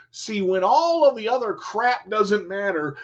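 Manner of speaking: normal talking voice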